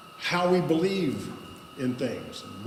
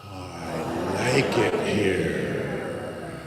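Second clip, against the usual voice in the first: deep, very slow chest voice; low, slow natural voice; 8 semitones lower, much slower than usual. Shown as a demonstration. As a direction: drop it low and draw it out. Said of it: "Let's do it one more time".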